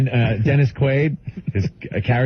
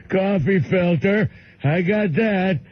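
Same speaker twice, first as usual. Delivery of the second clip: slow, higher-pitched simple-man voice; Bill; 6 semitones higher, slower than usual. Retold or imitated. Bill learning the coffee machine, slow high caricature. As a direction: repeat it like an earnest slow learner. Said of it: "They taught him".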